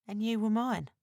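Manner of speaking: hint of sadness. A narrator's reading. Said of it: "with a hint of sadness".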